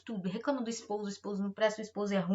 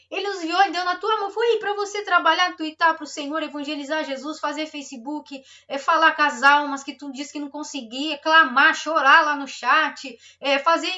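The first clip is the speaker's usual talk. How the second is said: rising preaching; 3 semitones higher, 6 dB louder than usual. Acted. Pastor exhorting the sisters to use their phones, building to full force. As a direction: push harder with each line, like a fiery call.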